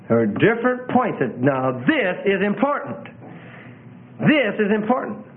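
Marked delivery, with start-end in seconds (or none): 1.4-5.2 THIS stressed, with space around the phrase; emphatic. Shown as a demonstration. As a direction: make this is important land twice, THIS raised. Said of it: "you need to say that by the way you talk".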